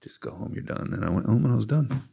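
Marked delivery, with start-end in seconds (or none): 0.0-0.9 soft unvoiced whisper, repeated; God's whisper. God telling him he is done, hushed whisper. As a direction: whisper the verdict gently. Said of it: "he was like".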